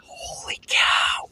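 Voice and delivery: breathless whispered gasp of awe; gasp, then whisper it in wonder; awed whisper. Retold or imitated. Gasp at the falls.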